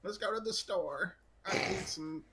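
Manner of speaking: high-pitched, giggly, barely holding it in; can't stop laughing. Real, not acted. Player trying to move on to the store, helplessly giggly. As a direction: push the words out through real laughter. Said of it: "I can't stop laughing".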